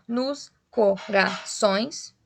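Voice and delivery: very slow "Nos co-ra-ções", one beat each; counting poetic syllables. Shown as a demonstration. Teacher counting ten poetic syllables, slow separated beats. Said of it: "assim eu conto dez sílabas poéticas".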